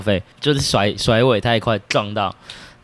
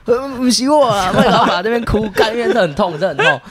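much higher, whiny pained voice, laughing; imitating the wrongly beaten senior; 10 semitones higher than usual. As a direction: mimic the victim wailing it hurts. (模仿挨打学长哭诉)

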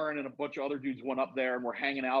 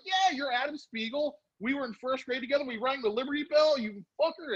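high-pitched, elated rush of words; excited reunion; 11 semitones higher than usual. Retold or imitated. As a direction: reenact a giddy reunion with an old friend. Retold excited reunion with classmate.

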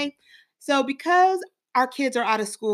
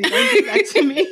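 higher, louder with laughter, near tears; laughing, teary; 9 semitones higher, 5 dB louder than usual. Real, not acted. Co-host touched by kids' messages, laughing and teary. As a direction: laugh it out, moved almost to tears. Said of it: "I could cry easy".

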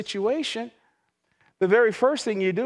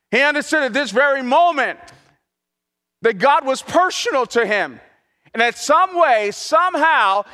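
rising, louder preaching build; 4 semitones higher, 7 dB louder than usual. Acted. Pastor telling the congregation of David's loss, preaching louder and higher.

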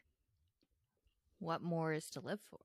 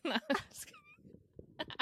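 laughing. Real, not acted. Joking after sad bee pun.

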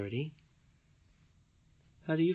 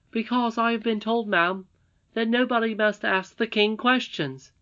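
put-on higher, louder earnest boy voice; a young miner boy asking boldly; eager boy Curdie; 10 semitones higher, 5 dB louder than usual. Reading to her child, voicing Curdie as an earnest higher boy.

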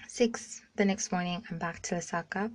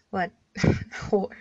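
laughing, louder on the last word; laughing outburst; 8 dB louder than usual. Retold or imitated. Mock outraged slur, laughing.

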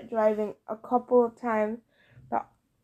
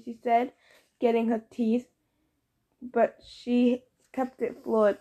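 plain reading voice

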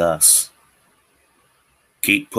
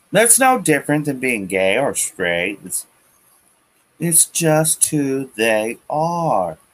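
higher, slow mock sincerity; mocking their argument; 7 semitones higher than usual. Ranter mocking opponents' excuse in higher, slow sneer. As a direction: parrot the other side with a sneer.

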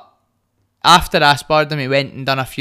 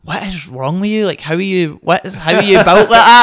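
baffled friend, rising louder and higher; mock incredulity; 4 semitones higher, 7 dB louder than usual. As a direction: build to an astonished, laughing outburst. Acts out friend's disbelief.

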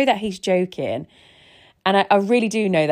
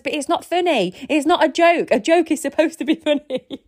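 exasperated retort; 9 semitones higher than usual. Retold or imitated. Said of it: "I says".

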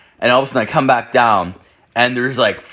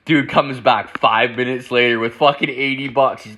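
ordinary talk

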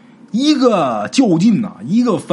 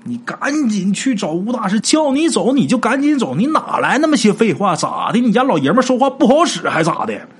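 gruff shout; 7 semitones higher than usual. Acted. Young man gruffly shouting at the girl to leave. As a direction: bark at her like a bossy husband. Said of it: "陈四儿赶紧喊".

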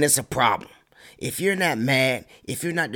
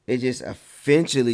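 ordinary delivery

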